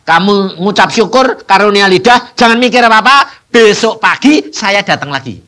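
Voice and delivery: loud firm encouragement. Retold or imitated. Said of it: "saya bilang".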